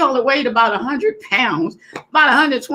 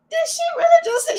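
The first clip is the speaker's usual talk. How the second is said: shrill squeal of disbelief, much higher; stunned high cry of disbelief; shocked disbelief; faster than usual. Retold or imitated. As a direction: squeal it like you can't believe your ears. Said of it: "I was like".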